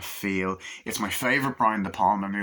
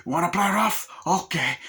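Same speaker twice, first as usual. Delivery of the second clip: Tony Montana impression; 8 semitones higher than usual. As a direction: goad them, voice pitched way up. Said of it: "And he's like".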